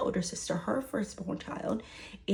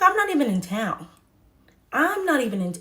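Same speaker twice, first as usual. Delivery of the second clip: higher, louder retort; indignant protest; 5 semitones higher, 7 dB louder, faster than usual. Retold or imitated. Telling mom she's out of town, indignant protest. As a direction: push back hard, voice jumping up. Texting back in argument with mom.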